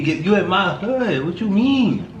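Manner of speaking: playfully outraged comeback; indignant. Retold or imitated. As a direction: argue it with rising disbelief. Husband re-arguing his indignant side.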